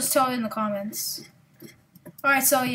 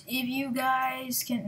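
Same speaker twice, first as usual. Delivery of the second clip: normal talking voice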